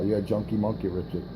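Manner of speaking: usual speaking voice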